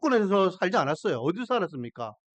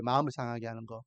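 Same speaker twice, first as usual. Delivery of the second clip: much lower and softer, almost to himself; quiet echo; 10 semitones lower, 9 dB quieter than usual. Shown as a demonstration. Preacher quietly repeating his point to the congregation, hushed. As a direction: let the phrase sink into a hushed repeat. Repeated phrase, dropped low.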